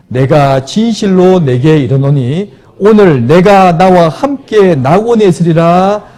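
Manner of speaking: slow, loud and lifted, like Jesus promising paradise; solemn proclamation. Acted. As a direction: stretch every word out as a holy promise. Preacher voices Jesus' promise. (느리고 크게, 엄숙한 선포)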